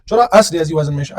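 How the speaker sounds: normal talking voice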